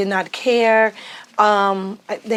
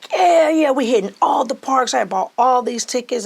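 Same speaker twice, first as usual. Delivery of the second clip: imitating excited kids; 5 semitones higher than usual. Kids hyped in park line.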